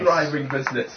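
usual speaking voice

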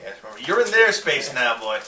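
loud sneering threat; mocking alien captain. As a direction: jeer down at them like prey. GM as Vargr captain.

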